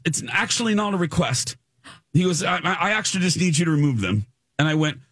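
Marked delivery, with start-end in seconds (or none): 0.0-1.6 higher, lightly put-on British accent, dismissive; a hint of a curt British set guy. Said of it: "he was so dismissive". 2.4-4.2 fast, higher, lightly British and brisk; dismissive British accent. Retold or imitated. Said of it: "he was so dismissive".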